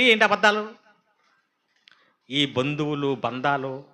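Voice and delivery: normal talking voice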